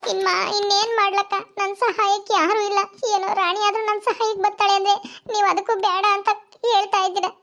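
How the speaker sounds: high-pitched voice of a weepy neighbour; tearful pleading woman. Acted. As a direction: beg tearfully for a favour.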